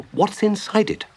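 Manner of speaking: high-pitched eager question; curious Mole. Acted. Mole asking about the fat basket, curious. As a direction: ask with wide-open curiosity.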